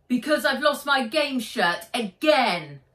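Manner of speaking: Charlotte's angry voice. Acted.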